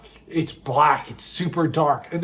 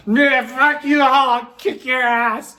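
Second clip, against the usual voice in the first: put-on higher, slow drunken threat; 6 semitones higher, 3 dB louder, slower than usual. Drunk friend picking fights, slurred threat. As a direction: slur it like a belligerent drunk. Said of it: "She's just".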